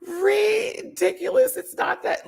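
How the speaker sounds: high, laughing, slow ridiculous; breaking up in laughter; crying laughing. Real, not acted. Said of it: "Lux had me crying".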